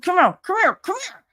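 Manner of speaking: very high, repeated beckoning; urgent summons. Retold or imitated. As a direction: act out calling a colleague aside. Urgently calling the teacher aside.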